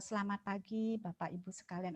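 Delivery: a deflated, soft "Selamat pagi" trailing away; low-pitched greeting. Shown as a demonstration. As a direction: greet the room with no energy, sinking at the end. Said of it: "Aduh, jangan seperti itu ya".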